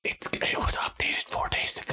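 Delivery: hushed whisper so the call can't hear. Real, not acted. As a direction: whisper it under your breath. Whispering during the phone call.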